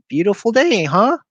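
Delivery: high, squeaky nervous small talk; squeaked. A narrator's reading. Scared boy squeaks small talk at the grinning bully. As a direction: squeak it, trying to sound casual. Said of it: "I squeaked".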